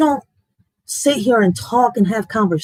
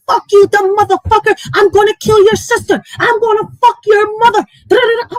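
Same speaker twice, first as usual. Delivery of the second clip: high, loud mock rage shouted at a stranger; screeching fake tough-guy threats; mock troll threats; 11 semitones higher, 5 dB louder than usual. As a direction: ham up the furious troll yelling threats. Mocking troll threats online.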